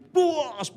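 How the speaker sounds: explosive high boom, falling away; crashing boom. Retold or imitated. Preacher acting out a shattering ship window, explosive boom. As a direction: burst out the crash of breaking glass.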